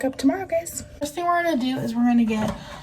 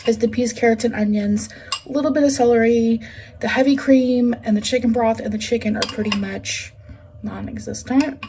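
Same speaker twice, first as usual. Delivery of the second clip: usual speaking voice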